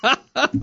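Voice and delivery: real quick laugh mid-story; short laugh. Real, not acted. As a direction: let a laugh slip out.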